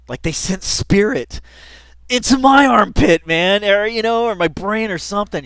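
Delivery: rising into a wild rant; excited. Real, not acted. Telling viewers how the clip got to him, worked up and loud.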